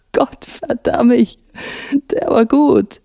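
high, drawn-out voice after a laugh; acted breathless amusement; wheezy amused reaction. A narrator's reading. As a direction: act winded by a good joke. Older cop has just brayed laughing.

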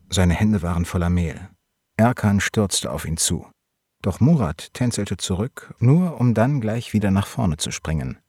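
plain reading voice

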